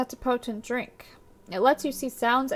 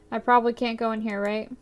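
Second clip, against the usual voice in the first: usual speaking voice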